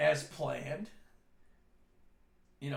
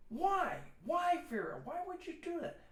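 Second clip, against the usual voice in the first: high-pitched imitation of a baffled husband; exasperated pleading; 13 semitones higher than usual. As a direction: plead in a raised, hammed-up voice. Father protests at wife's prank.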